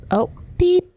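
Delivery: high sung beep, like a bleep tone; censor beep. Pretend. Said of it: "You're supposed to beep me".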